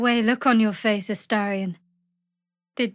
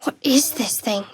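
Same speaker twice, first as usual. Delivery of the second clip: whispered question. Acted. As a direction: hush it, wary. Sneaking, finds an object.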